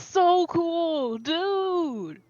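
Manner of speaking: high, slow, drawn-out pleading; whiny excitement. Acted. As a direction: stretch it out like a begging kid. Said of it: "how annoying I can be with this".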